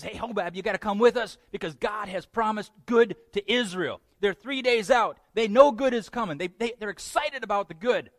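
a touch of excited invitation; excited Moses. Acted. Moses urges Hobab to come along, eagerly. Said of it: "Moses was excited".

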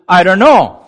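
loud, high-pitched protest of ignorance; exaggerated know-nothing reply; brazen denial. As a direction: deny it loudly. Cain's brazen denial, voiced.

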